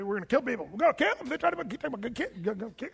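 high, fast, frantic mock ranting; fast mock rant rising high then trailing away; crazy YouTube guy. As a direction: ham up a wild-eyed ranter, words tumbling out, then fading off. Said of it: "there's a crazy guy that was on YouTube".

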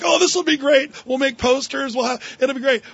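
mock-excited boardroom pitch; eager executives. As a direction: sell the idea with bright enthusiasm. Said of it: "And they're like".

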